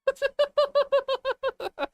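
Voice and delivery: high, loud fit of laughter. Real, not acted. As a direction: crack up helplessly. Streamer cracks up at chat.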